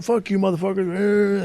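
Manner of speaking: high, drawn-out mock yell; mocking high-pitched retort; retold yelling. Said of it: "they start yelling".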